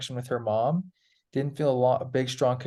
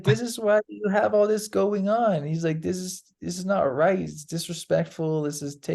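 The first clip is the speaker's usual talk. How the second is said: higher, lecturing voice; lightly voiced angry teacher; stern maestro; 6 semitones higher than usual. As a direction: scold him about respect. Maestro scolding student.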